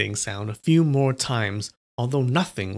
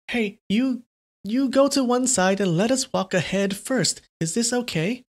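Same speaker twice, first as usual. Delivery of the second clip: much higher, curt nun voice; impatient nun; 9 semitones higher than usual. Acted. Nun demands the old man step aside, impatient. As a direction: tell him to step aside.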